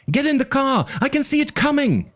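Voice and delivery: put-on high, urgent kid's voice; a frightened boy pleading over the radio. A narrator's reading. Arby urges Thorn and Malcolm into the car, high and urgent.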